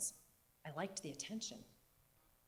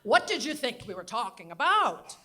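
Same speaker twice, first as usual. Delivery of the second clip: loud, matter-of-fact New England voice; reply with regal New England certainty; New England accent; 4 semitones higher, 12 dB louder than usual. Retold or imitated. Grandmother answering granddaughter, loud New England accent. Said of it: "New England Katharine Hepburn accent".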